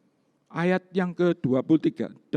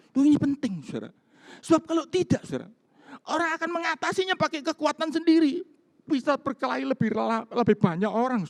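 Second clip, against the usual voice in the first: fervent preaching; 11 semitones higher, faster than usual. Real, not acted. Pastor urges his church to pray, in high fervent preaching. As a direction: drive the point home with fire.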